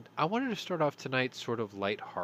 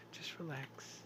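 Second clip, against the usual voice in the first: whisper; 4 semitones higher, 13 dB quieter, slower than usual. Real, not acted. Calming co-host after a joke.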